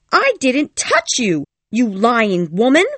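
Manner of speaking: high, loud, furious denial, slow at the start; raging cry. A narrator's reading. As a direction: scream the lie in a rage. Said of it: "cried Cathy, her ears all red with rage".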